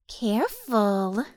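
slow, drawn-out warning; hissed warning. A narrator's reading. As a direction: draw the word out as a threat. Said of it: "Tammy hissed".

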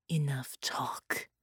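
slow breathy whisper, barely voiced. A narrator's reading. Carolina to Bela as she grips his throat, whispering. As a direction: hush him with a slow whisper. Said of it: "she whispered".

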